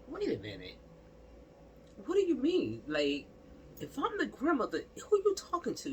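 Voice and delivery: indignant disbelief. Pretend. Grandmother confronting the aunt at the funeral, high indignant disbelief. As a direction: rise up high in offended shock. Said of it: "And I'm like".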